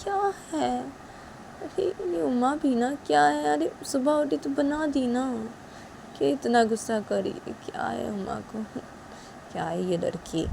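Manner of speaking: mother-in-law grumbling alone after Uma leaves. Acted. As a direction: puzzle over her temper.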